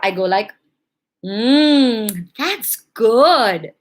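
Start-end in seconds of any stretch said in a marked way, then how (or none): 1.2-3.7 drawn-out "Mm" then a rising, pleased "that's good"; delighted. Shown as a demonstration. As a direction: savour it like a truly tasty cupcake. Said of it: "Just the way I said it".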